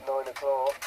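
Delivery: ordinary talk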